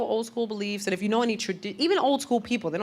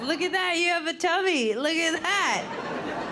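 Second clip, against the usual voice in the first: high cooing mock voice of a creepy guy; 10 semitones higher, slower than usual. Creepy guy teasing a woman's tummy, high cooing mock voice. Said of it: "The guy that goes".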